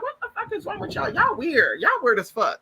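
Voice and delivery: high indignant auntie, dropping as it goes; cussing auntie. Retold or imitated. Revived aunt scolding the family, mock cussing auntie. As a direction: start way up high and come down, imitating a cussing aunt. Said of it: "she was a cussing auntie".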